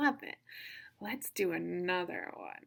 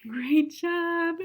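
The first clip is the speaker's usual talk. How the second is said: loud, high cheer; excited praise; 8 semitones higher, 11 dB louder than usual. Real, not acted. Celebrating children's correct guess.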